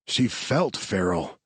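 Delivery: normal talking voice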